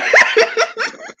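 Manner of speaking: loud high burst of laughter; cracking up hard at the clip; shrieking laugh. Real, not acted. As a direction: howl with laughter.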